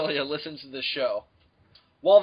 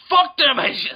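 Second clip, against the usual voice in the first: mock shout; 7 semitones higher, 7 dB louder than usual. Mock villain slogan shouted.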